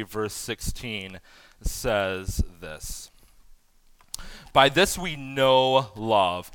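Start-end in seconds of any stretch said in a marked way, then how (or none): 4.5-6.5 slow, deliberate reading of "By this we know love"; slow scripture reading. Shown as a demonstration. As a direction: lay out "By this we know love" word by word, slowly. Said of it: "Okay, stop right there".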